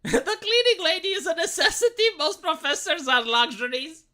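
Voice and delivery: laughing concession. Real, not acted.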